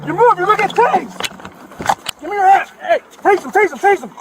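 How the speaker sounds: loud, high, urgent commands; officer shouting at the suspect; shouted orders. Real, not acted. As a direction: bark orders in the chaos of the arrest. Officers shout during the arrest.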